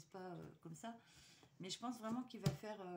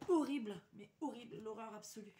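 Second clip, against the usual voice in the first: loud, exaggerated dismay; emphatic horror; 7 dB louder than usual. Real, not acted. Vlogger shows viewers an ugly lips design, loud horrified disgust. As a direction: punch each "horrible" loudly in disgust.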